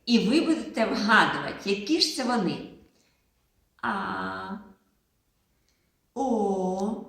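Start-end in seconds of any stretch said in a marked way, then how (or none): 3.8-4.5 long held А, vowel sound demo; drawn-out vowel А. Shown as a demonstration. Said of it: "я буду з Кузею промовляти звуки". 6.1-7.0 long held О, vowel sound demo; drawn-out vowel О. Shown as a demonstration. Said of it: "я буду з Кузею промовляти звуки".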